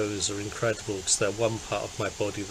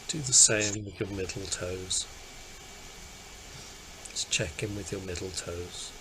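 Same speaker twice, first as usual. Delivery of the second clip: calm meditation voice, easing softer; guided meditation; 6 dB quieter than usual. Acted. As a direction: gently lead attention through the body, leaving long pauses. Leads a guided body scan.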